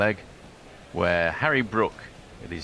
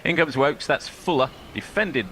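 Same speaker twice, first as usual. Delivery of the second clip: livelier restart; 7 semitones higher than usual. Shown as a demonstration.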